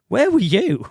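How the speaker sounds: mock-incredulous, pitched well up; incredulous quote. Teasing [name] over the Avril Lavigne podcast, mock-incredulous. Acting out their reaction to [name].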